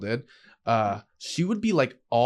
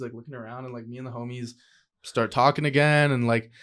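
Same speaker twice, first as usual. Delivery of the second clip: usual speaking voice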